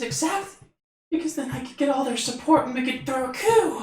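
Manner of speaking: high scheming little fairy. Acted. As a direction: plot sweetly in a tiny voice. Fairy plots.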